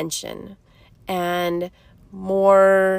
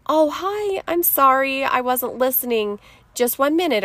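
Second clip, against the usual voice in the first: higher-pitched, gentle apology; acting out a kind reply, starting high and easing down; loving, kind; 5 semitones higher than usual. Parent answering a child calling their name, kindly. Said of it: "responding in a more loving, kind way".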